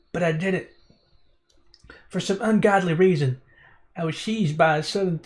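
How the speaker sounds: put-on drifting country accent. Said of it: "what this accent's turning into".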